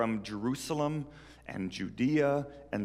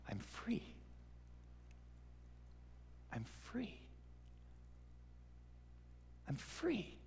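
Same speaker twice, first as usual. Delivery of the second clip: dazed wonder; 9 semitones higher, 18 dB quieter than usual. Acted. Freed man walking down hallway.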